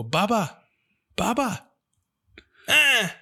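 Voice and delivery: put-on high, rising toddler call. The baby calling his father for ice cream, high toddler voice. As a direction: call for dad like a little kid. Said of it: "he'll go".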